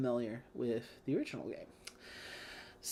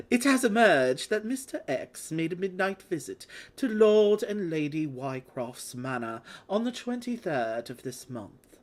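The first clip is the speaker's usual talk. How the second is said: put-on dramatic British news-report accent; a theatrical British newsreader voice; bad British accent; 2 semitones higher than usual. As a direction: read it like a hammed-up London newspaper. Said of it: "I'm just gonna do it".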